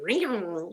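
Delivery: long, drawn-out "reow" yowl; imitated meow. Shown as a demonstration. Showing what she thought cougars go, yowling. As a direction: mimic a big cat's rolling meow. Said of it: "like that".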